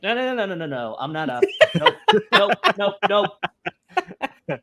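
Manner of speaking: panicked refusal. Acted. Student called on by the professor to speak, mock-panicked refusal. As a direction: laugh through a string of mock-panicked refusals.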